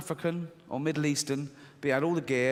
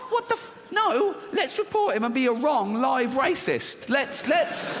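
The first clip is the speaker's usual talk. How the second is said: outraged inner voice; 8 semitones higher than usual. Retold or imitated. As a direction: squeal it in mock outrage.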